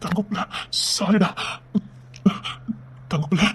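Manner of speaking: slow, soft fading words; dying farewell. Acted. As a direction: barely hold on as you say it.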